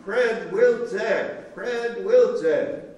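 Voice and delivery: loud, high, drawn-out imitated call; yelling down. Soldier summoning a Polish speaker down the column, imitated yell. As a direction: holler the name slowly down a long line. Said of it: "he yells down".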